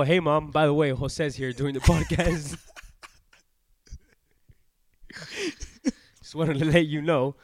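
calling out. Real, not acted. Telling Mom a friend is here podcasting, calling out. Calls to his mother during podcast.

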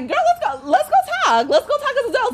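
high, laughing sing-song coaxing; mock sweet coaxing. Calling the roommate out to talk, high and mocking.

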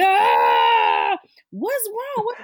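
high, mock sobbing wail; imitating crying child. Mother imitating her son crying about her coming out. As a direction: wail it like a crying four-year-old. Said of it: "burst out into tears, screaming".

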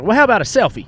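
high, loud, eager suggestion; mock a pushy fan's request; imitated hype woman. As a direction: overeager pitch parody. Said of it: "then she's like".

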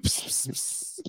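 whispered pss pss pss of web shooters; web-shooting sound. Shown as a demonstration. Swinging through trees in Fortnite, soft unvoiced pss sounds. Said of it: "I'm just like".

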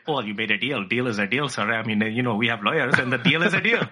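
a hint of an Indian accent, politely firm; mock Indian accent. CEO Parag answering Elon in a mock Indian accent. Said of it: "You don't know that he has that accent".

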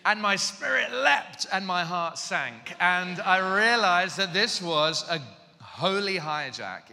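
loud, slow, high declamation; dramatic realization. Real, not acted. Preacher tells audience of realizing God set him up, dramatic. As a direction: proclaim each phrase with heavy, stretched drama. Mock-epic climax of realization.

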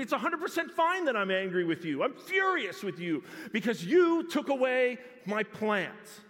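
a prophet raging over a plant; furious Jonah. Acted. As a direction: spit out the fury.

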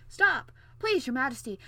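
high, loud interruption easing off; sudden loud plea to stop; urgent call. Acted. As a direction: call out to halt someone, then soften. Prince calls the departing king back.

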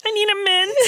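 high, croaky froggy voice. Real, not acted. Daughter hears mom's throat act up, froggy voice. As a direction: croak it out like a frog. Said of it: "That's my froggy voice".

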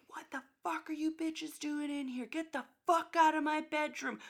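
mock scream. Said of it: "starts screaming at us".

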